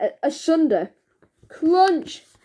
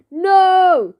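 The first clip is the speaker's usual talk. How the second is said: loud, high one-word refusal; shouted no; 4 semitones higher, 5 dB louder than usual. A narrator's reading. Barry refuses detention, bellowing. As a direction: bellow it back defiantly. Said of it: "shouted Barry".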